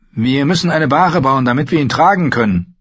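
brisk and practical; lightly voiced wildling woman. Osha urges the boys, brisk voice. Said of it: "sagte Osha".

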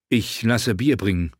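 plain reading voice

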